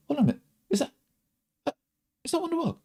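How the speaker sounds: very high, put-on wondering voice; mock amazement, pitched high and halting; fake surprised recognition. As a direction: act out hammed-up dawning recognition. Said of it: "it's fake".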